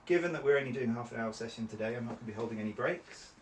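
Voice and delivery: usual speaking voice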